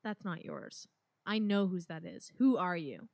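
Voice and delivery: building to a loud challenge; angry confrontation. Acted. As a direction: confront her, rising to a shout. Confronting the lady angrily.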